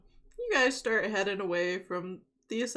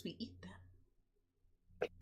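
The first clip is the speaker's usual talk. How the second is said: slow, whispered menace; creepy whisper; 14 dB quieter, much slower than usual. Acted. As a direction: whisper it slowly and darkly. GM delivers a dark punchline.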